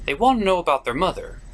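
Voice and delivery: ordinary talk, nothing marked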